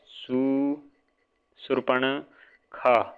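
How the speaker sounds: Shurpanakha whispered slowly, no voice; whispered spelling of Shurpanakha. Real, not acted. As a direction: breathe out Shurpanakha syllable by syllable. Teacher sounding out the word.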